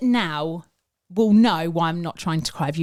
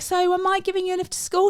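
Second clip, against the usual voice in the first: pointed question; 10 semitones higher, faster than usual. Retold or imitated. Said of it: "And I'm like".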